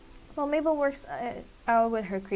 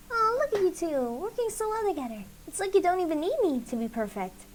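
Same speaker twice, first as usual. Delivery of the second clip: put-on much higher, sing-song matchmaker girl; bubbly Mabel voice; 7 semitones higher than usual. Mabel teasing Ford and Sylvia while baking, high and gushing. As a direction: pitch it way up, gushing and delighted.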